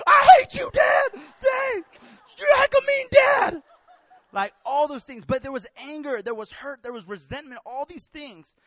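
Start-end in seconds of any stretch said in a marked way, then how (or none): 0.1-3.6 put-on very high, very loud bratty kid shout; child tantrum yell. Boy yelling at dad who said no, shrill tantrum. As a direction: scream it like a denied child.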